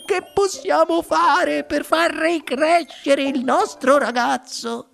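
high, slow tearful plea; sobbing. A narrator's reading. Mother begs to regrow her boy, sobbing. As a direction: wail the question through sobs. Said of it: "singhiozzò la signora T V".

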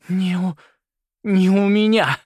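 high, loud angry outburst; spat out. A narrator's reading. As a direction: spit the words out, rising in force. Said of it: "выплюнула она". (зло выплюнуть слова)

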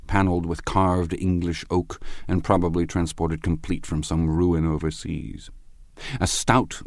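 usual speaking voice

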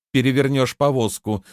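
ordinary delivery, nothing marked

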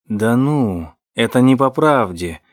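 ordinary delivery, nothing marked

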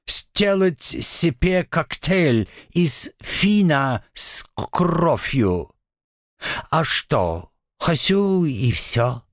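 slow, high petulant demon; sulky devil. Acted. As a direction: insist like a stubborn child.

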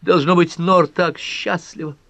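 high, delighted gush; thrilled, high-pitched joy; joyful exclamation. Acted. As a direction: carry the excitement on in a soaring voice. Pat rejoices about the baby.

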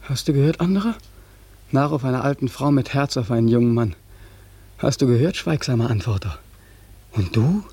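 usual speaking voice

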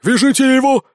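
high, loud battle command; exclaimed order. A narrator's reading. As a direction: shout an urgent order to the team. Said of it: "воскликнул Спирт". (громкий крик-приказ)

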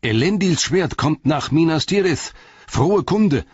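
众人惊喜高呼; crowd exclaiming. A narrator's reading. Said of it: "riefen manche laut aus".

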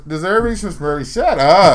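high, loud laughing brush-off; mock dismissal. Host waving off talk of deserving contenders, loud mock scorn. Loudly echoing the dismissive rhyme.

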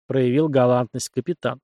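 usual speaking voice

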